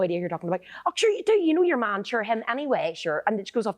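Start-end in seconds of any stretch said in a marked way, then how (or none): 0.8-3.2 insistent Irish mammy. Retold or imitated. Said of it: "My ma is brilliant at doing".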